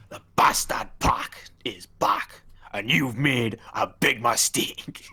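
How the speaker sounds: slow, drawn-out Geordie promo voice; Geordie accent. Acted. Redoing Pac's comeback promo for a co-host, Geordie accent. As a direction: put on a thick Geordie accent, word by word. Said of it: "Gotta do it in the Geordie accent".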